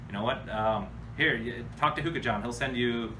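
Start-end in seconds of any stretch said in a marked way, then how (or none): none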